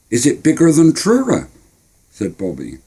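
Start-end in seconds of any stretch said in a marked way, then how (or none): 0.1-1.5 put-on high, curious young boy; eager kid voice. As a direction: ask it wide-eyed. Boy asks.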